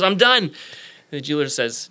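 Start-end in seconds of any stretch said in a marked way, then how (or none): 0.0-0.4 high, loud "done"; raised, punchy and keen; eager announcement. Acted. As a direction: act out a kid proudly calling out he's finished. Boy insists again after two hours.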